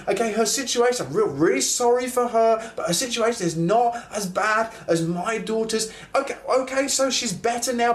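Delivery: high, urgent pleading with Jesus; frantic impatience. Retold or imitated. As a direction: hurry him up, a desperate father losing patience. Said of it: "I would be thinking".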